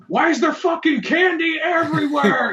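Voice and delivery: high, exasperated outburst; exasperated mock yell. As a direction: yell it like an overwhelmed uncle. Acts out exasperated uncle.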